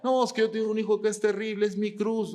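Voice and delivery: high, loud, fast mock lament; mocking complaint. Preacher mocking how people talk about their cross, high fast lament. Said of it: "Así dicen, ¿no?".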